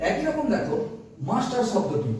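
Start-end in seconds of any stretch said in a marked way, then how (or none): none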